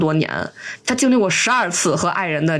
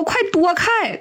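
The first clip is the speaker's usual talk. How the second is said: urgent warning shout; 9 semitones higher, slower than usual. Retold or imitated. Falling old Liu warns the woman below, high mock warning. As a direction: warn her off in a raised, light retold voice. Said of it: "他就赶紧喊说".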